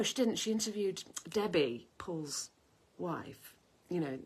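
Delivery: ordinary talk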